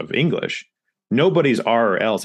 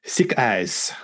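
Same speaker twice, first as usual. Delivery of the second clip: "sick eyes" for thick ice, French learner sounds; French slip, s for th and z for s; French speaker's mispronunciation. Retold or imitated. Quoting a French speaker for comparison, sick eyes. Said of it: "also a French speaker was talking about".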